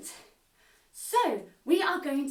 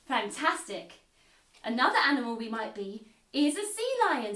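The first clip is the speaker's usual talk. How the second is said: ordinary delivery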